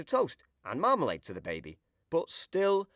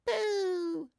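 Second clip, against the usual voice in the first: put-on very high cheerful baby "Pooh"; a toddler copying the word; 4 dB quieter than usual. A narrator's reading. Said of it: "says the baby".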